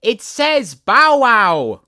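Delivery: high, loud and slow little daisy; a tiny flower calling up proudly; daisy crying out. A narrator's reading. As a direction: cry out the joke gleefully. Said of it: "cried a daisy".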